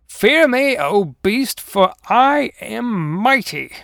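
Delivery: high, loud, drawn-out battle cry; hammed-up mighty tiger shout; grandiose yell. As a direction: proclaim your might, stretching the last words. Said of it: "the paper tiger yelled".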